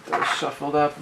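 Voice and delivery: usual speaking voice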